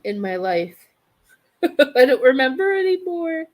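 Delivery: normal talking voice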